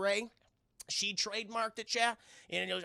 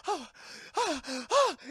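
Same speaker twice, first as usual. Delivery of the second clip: high, loud gulps of air; acted breathless horror gasps; stuck-scream gasps; 8 semitones higher, 5 dB louder than usual. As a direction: gasp as if the scream won't come out. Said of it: "stuck in your throat".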